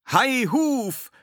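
high, loud rallying cry; ringing high shout; calling out. A narrator's reading. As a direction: holler it as you reach the beach. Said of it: "riefen sie".

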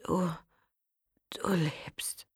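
faint dying whisper. Acted. Dying Matteo whispering to Aroa, faint whisper. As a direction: barely breathe the words. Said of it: "kaum mehr als ein Hauch".

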